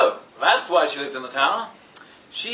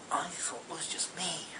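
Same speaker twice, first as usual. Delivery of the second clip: breathy confiding whisper; 16 dB quieter than usual. Acted. Prince confessing to shopkeeper, hushed whisper. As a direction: whisper it like a guilty secret.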